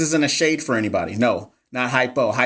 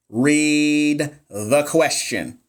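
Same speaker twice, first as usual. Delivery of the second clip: drawn-out, each word stretched; slow, deliberate; 2 semitones higher, much slower than usual. Shown as a demonstration. Tutor slowing down so viewers read carefully, drawn out. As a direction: spell it out slowly for the class. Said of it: "I'm gonna read it slow".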